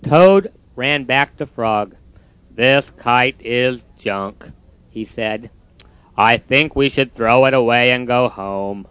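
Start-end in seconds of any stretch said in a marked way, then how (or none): 2.5-4.5 glum Toad. Acted. Toad's voice. 6.2-8.9 glum Toad. Acted. Toad's voice.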